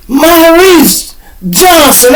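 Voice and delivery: very high, slow, loud drawn-out name; host calling the winner across the casino; announcer call. Retold or imitated. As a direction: stretch the name like a raffle host. Announcer calls the full name.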